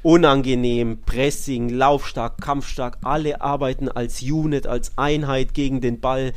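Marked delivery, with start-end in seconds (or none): none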